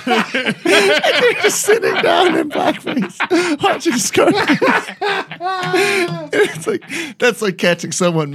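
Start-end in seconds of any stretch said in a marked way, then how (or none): 0.1-6.8 laughing. Pretend. Picturing a friend walking in.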